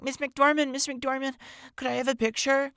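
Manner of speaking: put-on high, eager teenage plea, calling after a star; teen fangirl voice. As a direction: call out like a starstruck seventeen-year-old. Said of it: "I think seventeen-year-old voice".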